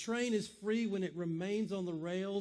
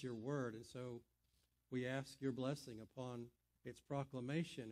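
softer, hushed prayer voice that fades at phrase ends; pastoral prayer; 3 semitones lower, 4 dB quieter than usual. Acted. Pastor praying for blessing on the sermon, soft and reverent. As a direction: pray gently and humbly for the room.

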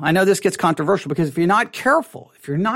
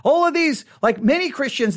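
usual speaking voice

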